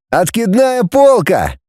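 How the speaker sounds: slow, high, thrilled announcement; exclaiming. A narrator's reading. Vladimir admires the fold-down bunk, exclaiming in delight. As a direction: draw the words out in high delight. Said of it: "воскликнул он".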